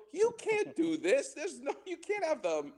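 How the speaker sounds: high-pitched, scandalized objection; shocked protest. Retold or imitated. Student to driver on a donut run, scandalized protest. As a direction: play up disbelief, voice leaping up and stretching the opening.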